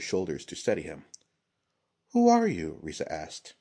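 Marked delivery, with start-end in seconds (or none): 2.1-2.8 high, louder curious question; woman warrior voice. A narrator's reading. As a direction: ask it like the scarred ronin. Risa questions the prince.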